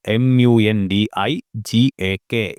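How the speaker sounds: M U N D I G A K, each letter said slowly and separately; spelled out letter by letter. Shown as a demonstration. Teacher spelling a site name for students, slow letters. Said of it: "spelling നോട്ട് ചെയ്ത് വെക്കുക".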